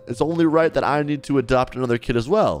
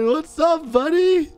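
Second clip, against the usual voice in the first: put-on cooing to a child; 13 semitones higher, slower than usual. Greeting a shy young kid.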